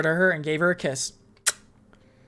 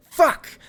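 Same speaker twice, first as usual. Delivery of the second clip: high sharp pained yelp; cry of pain; 10 semitones higher, 4 dB louder than usual. Acted. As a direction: cry out as if stabbed. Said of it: "I cried out in pain".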